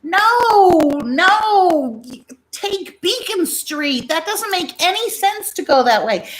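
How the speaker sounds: high excited interjection. Retold or imitated. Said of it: "I also interject".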